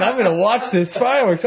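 high, eager voice; gleeful anticipation. Acted. Jonah savoring the city's expected doom, gleeful. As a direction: relish the coming show. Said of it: "He's really happy".

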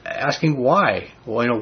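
usual speaking voice

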